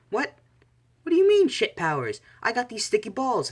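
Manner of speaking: very high, loud indignant squeal; outraged protest. Acted. Mineta objects to being called useless, high outraged yelling. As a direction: shout it back in a high-pitched, offended voice.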